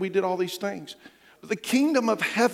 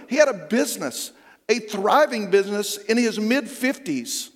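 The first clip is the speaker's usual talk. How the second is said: building pulpit preaching; 4 semitones higher than usual. Acted. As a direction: preach it home with conviction.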